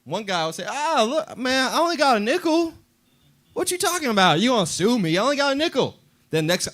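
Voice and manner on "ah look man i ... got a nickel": high, mocking nasal-free jeer, much higher; a thief mocking the man he robbed; taunting thief. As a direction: play the smug petty thief shrugging it off. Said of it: "Everybody would taunt him like".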